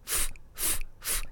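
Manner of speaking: 'ふ' as voiceless puffs of English F; isolated F sound. Shown as a demonstration. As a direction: lip on teeth, blow short breaths for 'ふ'. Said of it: "下の唇を上の歯で少し噛んで". (「ふ」を唇を噛んで息だけのFの音で)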